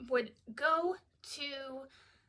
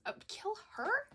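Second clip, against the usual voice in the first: incredulous; 9 semitones higher than usual. Real, not acted. Mocking the wrong pronoun.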